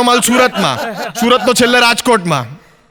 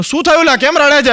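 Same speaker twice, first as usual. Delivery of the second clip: concerned question; 6 semitones higher than usual. Retold or imitated. Asking crying friend.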